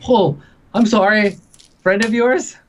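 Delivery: high, mock-innocent Connor voice with food in his mouth; mouthful innkeeper. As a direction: play it airy and innocent while chewing. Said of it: "and he's like".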